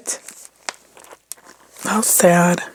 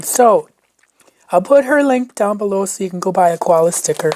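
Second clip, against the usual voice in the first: ordinary delivery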